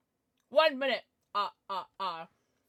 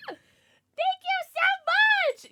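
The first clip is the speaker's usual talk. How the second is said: very high, loud, slow squeal; squealing thanks; 11 dB louder, much slower than usual. Real, not acted. Streamer thanking Moses for the gift, squealing.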